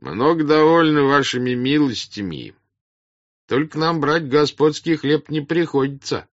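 high, loud voice from the back; peasant in the crowd. Acted. Peasant declines the master's bread, calling from behind. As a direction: call out over the crowd's heads. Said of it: "сказал голос сзади".

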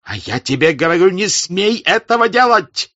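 loud, high forbidding yell; shouting. Acted. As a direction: shout to stop him. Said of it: "закричал Денисов".

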